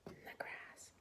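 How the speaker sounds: "In the grass" whispered softly. Shown as a demonstration. Teacher echoes the answer to the children, whispering. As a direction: breathe "In the grass" as a secret.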